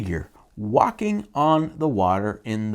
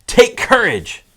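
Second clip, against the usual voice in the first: higher, louder commanding voice; hammed-up bold command; mock firm; 7 semitones higher, 7 dB louder, slower than usual. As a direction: play it big and heroic. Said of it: "he says".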